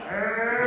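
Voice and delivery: loud, high mock bawling; hammed-up ugly-boy cry; ugly crying wail. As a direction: wail it out like an exaggerated sob. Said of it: "that ugly boy cry".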